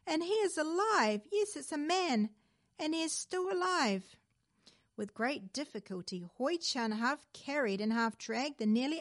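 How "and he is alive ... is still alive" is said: high excited calling out; excited cry. A narrator's reading. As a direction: cry it out with joy. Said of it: "cried the old fisherman".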